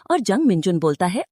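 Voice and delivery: ordinary delivery, nothing marked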